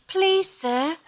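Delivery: high, drawn-out fake British orphan; bad British accent. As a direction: plead in a hammed-up British accent. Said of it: "this really bad accent".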